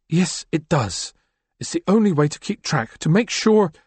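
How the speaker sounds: a woman insisting on her ledger of debts; Chrysalis. Acted.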